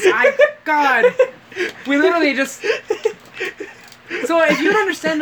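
high, loud words breaking into laughter; helpless laughter. Real, not acted. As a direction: struggle to talk through real laughing. Helpless laughter while trying to talk.

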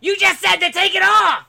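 high, loud put-on outburst; mock exasperated yell. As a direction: yell back like a fed-up welder. Acting exasperated welder snapping back.

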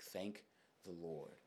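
low, very soft private prayer; hushed thanks. Retold or imitated. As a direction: breathe out a quiet thanks. Silent thanks when friends arrive.

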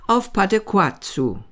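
usual speaking voice

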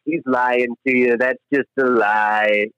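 slow, drawn-out warning; slow mock warning. Renee Zellweger warning Heather about Barry, slow. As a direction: spell it out deliberately.

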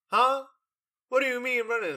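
high, loud imitation of a baffled father; incredulous dad. Dad's reaction acted out in retelling.

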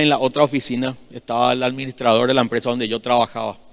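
usual speaking voice

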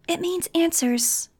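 put-on slow, high, eerie child voice; eerie authority. A narrator's reading. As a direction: speak with uncanny certainty. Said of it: "with that weird authority she had".

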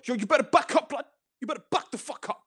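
high-pitched mock chav threat. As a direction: imitate a chav squaring up to a zombie. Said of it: "they'd be like".

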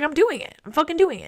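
high, ringing declaration; fired-up resolve. Retold or imitated. Vlogger retelling her decision to enrol, fired up. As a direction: announce it like a triumph. Resolve quoted, much higher.

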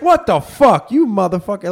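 high, loud imitated curse; mock outrage. Con man's victim yelling at the grandfather. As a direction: blurt it like a conned man.

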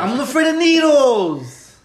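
slow, loud, mock-dramatic confession; a loud, slow cry of fear of needles; drawn-out loud wail. Real, not acted. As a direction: stretch it out, loud and high.

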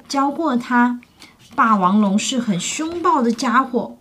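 ordinary delivery

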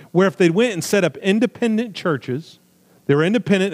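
usual speaking voice

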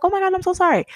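high-pitched mock apology; retold apology. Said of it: "apologizing after I've pushed".

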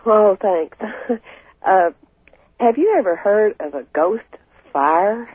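normal talking voice

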